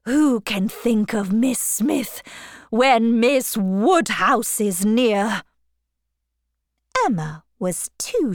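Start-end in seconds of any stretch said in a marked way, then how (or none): 0.0-5.4 slow, drawn-out declaration; fervent suitor, measured and emphatic; ardent cry. A narrator's reading. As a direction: press the point, lingering on each word. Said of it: "cried Mister Elton".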